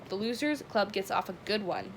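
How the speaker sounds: ordinary delivery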